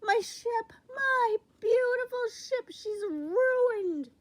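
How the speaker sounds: very high, loud anguished wail; mighty roar. A narrator's reading. King from below to pirates, loud wailing roar. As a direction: cry out over a wrecked ship. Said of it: "roared a mighty voice".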